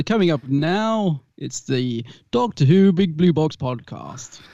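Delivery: slow mock trailer announcer. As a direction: announce the podcast like an old BBC trailer, slowly. Mock trailer announcer after clip.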